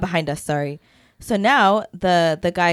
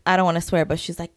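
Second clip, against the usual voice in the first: normal talking voice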